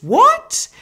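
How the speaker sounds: high squeak of mock disbelief; squeaky what. Real, not acted. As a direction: shoot what way up in amazement. Disbelief at Harvey's voice.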